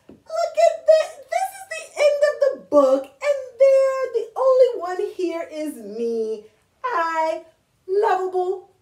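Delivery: high, loud furry monster revealing himself; a cheerful, loud Grover announcing he is the monster. Acted. Reads Grover's lines in character.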